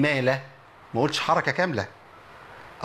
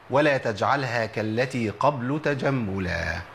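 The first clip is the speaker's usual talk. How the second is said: slow chanted recitation of the verse; recited verse; 5 semitones lower, much slower than usual. Shown as a demonstration. Teacher reciting a rule to students, slow chant. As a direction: intone the line slowly like a teacher reciting. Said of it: "قول أحد العلماء".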